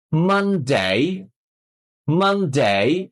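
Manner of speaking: মানডে with a long gliding "ay" in ডে; posh pronunciation. Shown as a demonstration. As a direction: draw out "man-dey", tune dipping then rising. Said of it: "হুবহু উচ্চারণটা করতে হবে".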